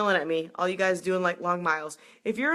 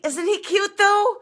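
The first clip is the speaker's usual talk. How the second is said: high squeal, getting louder to the end; excited gushing; 11 semitones higher, 6 dB louder, slower than usual. Real, not acted. Runner asks viewers about the cute find, squealing. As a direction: gush it up high, rising into a squeal.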